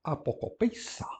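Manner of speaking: "apocopeissa" said softly as a-po-ko-PEI-sa; Greek word modelled. Shown as a demonstration. Teacher reads a participle to the class, soft and careful. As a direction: breathe out "apocopeissa" softly, syllable by syllable. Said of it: "Apocopeissa é um particípio presente".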